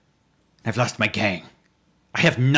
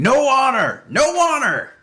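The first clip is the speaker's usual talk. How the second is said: high, loud challenge shout; 12 semitones higher, 4 dB louder, slower than usual. Acted. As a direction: yell it like a furious warrior.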